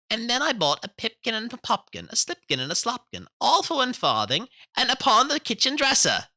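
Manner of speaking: high, loud cheeky mouse voices; rude little mice. A narrator's reading. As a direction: tease him in tiny mouse voices. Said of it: "added the rude little mice".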